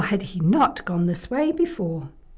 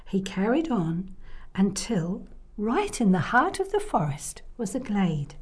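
ordinary delivery

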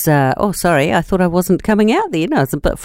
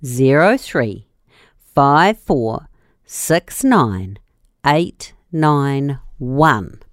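slow, deliberate number reading; slow dictation; 4 semitones lower, much slower than usual. Shown as a demonstration. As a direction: read each digit slowly for writing. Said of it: "Write it down".

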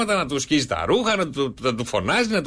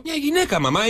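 small son voice; 12 semitones higher than usual. Acted. Son answers.

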